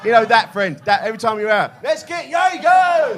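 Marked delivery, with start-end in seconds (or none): 1.8-3.2 put-on high, loud, drawn-out drunken yell; shouted rallying cry. That friend every time you're out, imitated loud shout. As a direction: mimic the mate rallying everyone for shots. Pushy friend acted on night out.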